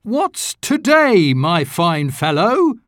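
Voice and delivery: high and slow, bubbling over; eager question. A narrator's reading. Scrooge asking the boy the date, eager and high. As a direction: draw the boy out with glee. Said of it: "said Scrooge".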